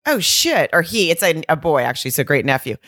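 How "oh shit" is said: mock dismay. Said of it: "And I was like".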